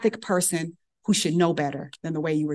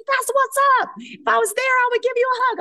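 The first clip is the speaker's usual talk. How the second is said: excited praise. Retold or imitated. Senior resident praising the intern, high excited cheer. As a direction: gush with approval.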